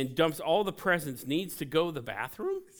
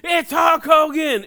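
much higher, much louder fan scream; ecstatic shout; 13 semitones higher, 13 dB louder than usual. Retold or imitated. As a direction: scream it like a fan losing his mind at the sight. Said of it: "he loses his ever-loving mind and goes".

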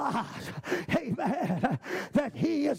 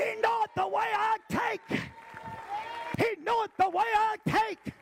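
high, held preacher's shout; sermon shout building to my God; shouting words; 7 semitones higher than usual. Acted. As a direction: cry it out over the church. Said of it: "those are shouting words".

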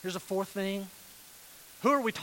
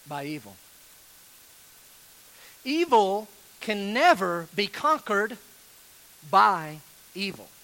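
slow, deliberate quote reading; reading slowly; slower than usual. Shown as a demonstration. Pastor reading Barclay to the church, slow and deliberate. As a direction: let each phrase land, slowly. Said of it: "I'm gonna read it slow".

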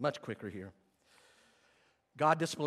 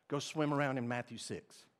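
ordinary talk

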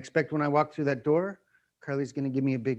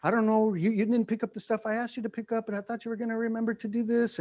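nagging wife; 6 semitones higher than usual. Retold or imitated. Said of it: "Carly's like".

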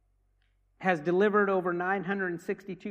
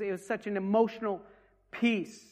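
ordinary talk

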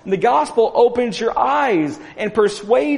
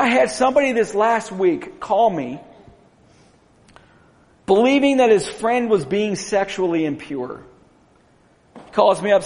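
usual speaking voice

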